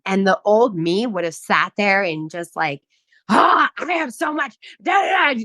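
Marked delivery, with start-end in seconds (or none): 3.3-5.4 mock frantic rant. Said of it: "just going off on them".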